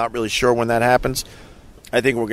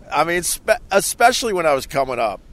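ordinary talk

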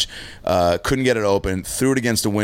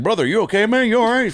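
much higher, worried roommate voice; concerned buddy; 13 semitones higher, 3 dB louder than usual. Retold or imitated. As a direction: play the friend checking on you through the door. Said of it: "So he's like".

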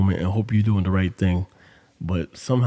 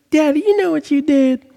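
put-on much higher, loud little girl scolding; daughter's voice; 6 dB louder than usual. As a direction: accuse like an upset five-year-old.

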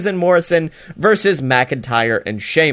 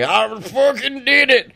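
mock smug triumph, pitched way up; Dana gloating; 9 semitones higher than usual. Dana brags about a cheap roll-up win, mock gloating. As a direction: crow it like a cocky winner. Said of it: "But Dana's like".

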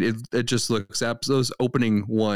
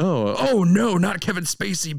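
a silly floating face recoiling; high mock voice; 10 semitones higher than usual. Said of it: "floating faces of celebrities".

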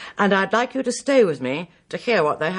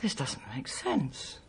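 whispered to herself; 12 dB quieter, slower than usual. Real, not acted. Puzzling over anonymous letter.

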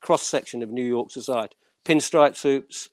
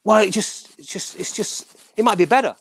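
acted quote; 9 semitones higher than usual. Producer pushes, voiced high.

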